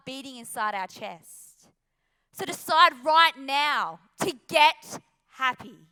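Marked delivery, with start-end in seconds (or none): 2.3-5.8 loud and slow, driving it home; loud, slow final call to decide now; rousing call. Real, not acted. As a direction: punch out the closing rallying cry.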